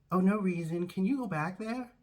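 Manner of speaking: lightly voiced high-pitched phone lady; a hint of a chirpy caller. Game show staffer on the phone, coy high voice.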